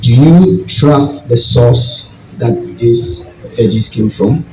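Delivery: ordinary delivery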